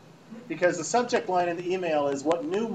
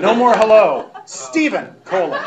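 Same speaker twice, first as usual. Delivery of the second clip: loud, higher, curt mock shout; yelling email writer; 4 semitones higher, 8 dB louder than usual. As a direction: act out her losing patience, at volume. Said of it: "she's clearly yelling".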